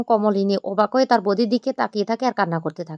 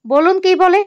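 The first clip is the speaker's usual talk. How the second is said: sharp raised demand, loud and high; demanding shout; 9 semitones higher, 6 dB louder than usual. Acted. Niece loudly demands the aunt say it. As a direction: push her hard for the answer.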